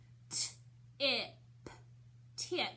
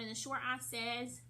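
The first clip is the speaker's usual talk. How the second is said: normal talking voice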